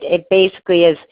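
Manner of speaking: ordinary talk, nothing marked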